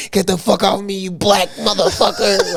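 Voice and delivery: high-pitched imitation of enraged roommate; mock furious outburst. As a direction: spit out the insult as the pinned man. Imitating pinned roommate's insult.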